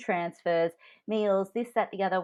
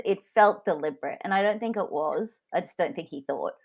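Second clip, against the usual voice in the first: ordinary delivery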